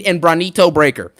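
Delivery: usual speaking voice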